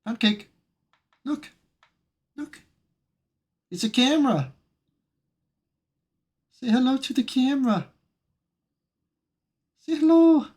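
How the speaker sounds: high, sweet coaxing of a dog; pet-talk voice. Real, not acted. Streamer showing the dog the camera, high coaxing.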